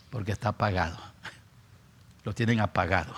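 normal talking voice

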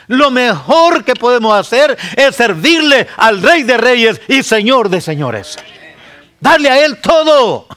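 high, loud, pleading sermon cry; full-force preaching. Acted. Preacher exhorting his congregation, loud and high.

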